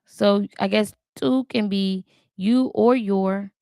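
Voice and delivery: normal talking voice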